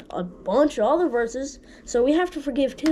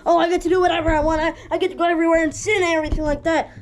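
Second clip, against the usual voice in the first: fast, higher and louder mock voice of someone excusing sin; mocking careless sinner; 5 semitones higher, 5 dB louder, faster than usual. Kid preacher mocking a viewer who twists her video, fast and high. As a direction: rattle it off like a smug rule-breaker. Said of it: "take my video away and say".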